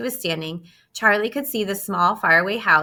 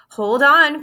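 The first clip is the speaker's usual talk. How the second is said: higher, louder warning call; cry out; 7 semitones higher, 9 dB louder than usual. Acted. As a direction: call it out to hold tight. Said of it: "cried Mister Wonka".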